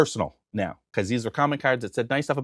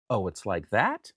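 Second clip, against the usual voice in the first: slow, low, softer challenge; low-key challenge; 4 semitones lower, 6 dB quieter, slower than usual. Retold or imitated. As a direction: draw it out quietly, lower. First take of his challenge.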